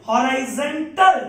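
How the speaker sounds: "Horizontal" very slow, each syllable held; drawn-out key answer. Real, not acted. Teacher telling students the curve's shape, long drawn-out emphasis. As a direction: stretch Horizontal out for the class to join in.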